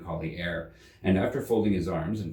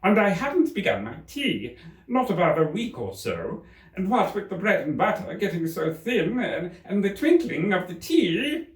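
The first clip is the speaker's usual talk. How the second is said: trembling Hatter; 10 semitones higher than usual. Acted. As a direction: fumble through the excuse in fear. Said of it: "in a trembling voice".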